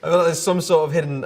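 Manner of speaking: words pushed out through a laugh; trying not to crack up; breaking into laughter. Real, not acted. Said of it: "to do with the laughter in the room".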